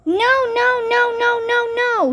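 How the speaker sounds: very high, loud screaming no's; toddler scream. A narrator's reading. Kalia screams while being rocked, very high and loud. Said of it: "screamed Kalia".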